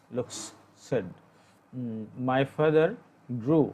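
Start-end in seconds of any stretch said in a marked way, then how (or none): 2.3-3.7 "grew" said as groo, slow and clear; slow dictation of "grew" as groo; grew said right. Shown as a demonstration. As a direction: model "grew" as groo for the class. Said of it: "গ্রিউ উচ্চারণটা ভুল, আর গ্রু উচ্চারণটা সঠিক".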